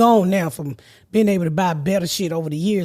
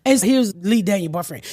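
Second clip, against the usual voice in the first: ordinary talk, nothing marked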